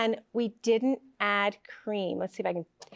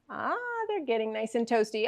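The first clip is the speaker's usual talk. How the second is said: ordinary talk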